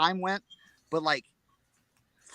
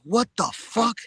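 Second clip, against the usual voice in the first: retold shock; 6 semitones higher than usual. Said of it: "I'm like".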